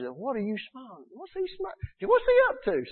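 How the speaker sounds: mock suspicious voice rising high; puzzled onlooker. Onlookers puzzled by a smiling man, mock suspicious voice rising high. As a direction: imitate people wondering what he is up to.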